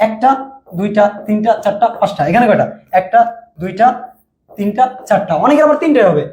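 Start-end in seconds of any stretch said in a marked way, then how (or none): none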